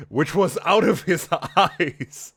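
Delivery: breaking into laughter mid-read; laughing. Real, not acted. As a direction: read it while cracking up.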